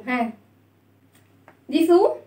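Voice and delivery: normal talking voice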